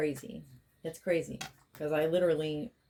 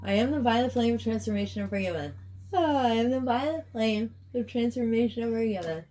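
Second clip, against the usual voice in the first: higher, sing-song chanted invocation; healing invocation; 5 semitones higher, faster than usual. Real, not acted. Healer invoking release for the Divine Aquarius Collective, chanted. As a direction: intone it like a ritual prayer.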